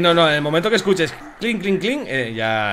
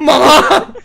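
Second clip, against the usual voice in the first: laughing shout; 13 semitones higher, 10 dB louder than usual. Real, not acted. Wild game moment, laughing.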